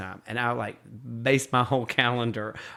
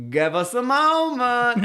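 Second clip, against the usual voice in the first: high, loud, drawn-out call; imitated imperious grandmother singing it out; Swoopy commanding; 12 semitones higher, 6 dB louder, slower than usual. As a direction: hold off a whole line grandly. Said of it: "and she'd be like".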